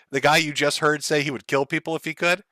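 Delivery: normal talking voice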